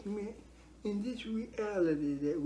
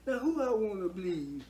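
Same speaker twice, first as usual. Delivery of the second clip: usual speaking voice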